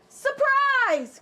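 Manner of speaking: spring it on her; cried out. Acted. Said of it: "cried the wolf".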